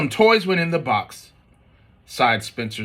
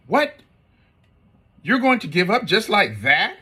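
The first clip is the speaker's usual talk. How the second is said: astonished mom; 7 semitones higher than usual. Acted. Mom stunned by easy agreement.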